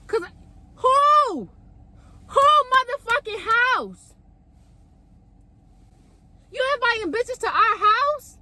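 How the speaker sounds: indignant outrage. Retold or imitated. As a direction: demand to know whose house it is, outraged. Retold jealous confrontation with boyfriend.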